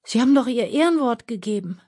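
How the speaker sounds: high, pained plea; dismayed, high-pitched reproach; moaning. A narrator's reading. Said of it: "stöhnte Aljoscha".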